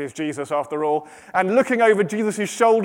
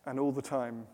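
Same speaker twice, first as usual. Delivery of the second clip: very soft, low, with long pauses; serene hush; 6 semitones lower, 13 dB quieter than usual. A narrator's reading. Serene refrain against Martha's frantic rush.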